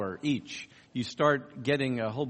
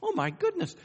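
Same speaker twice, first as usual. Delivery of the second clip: amazed exclamation. Retold or imitated. Reacting to the student's answer.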